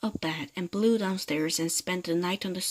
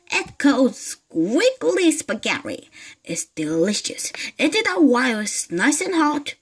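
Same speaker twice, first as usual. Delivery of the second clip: higher, louder, dips to a whisper; sneaky coaxing hag; 5 semitones higher, 5 dB louder than usual. Acted. Mrs Twit slyly urging her husband to eat. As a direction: coax him slyly to eat.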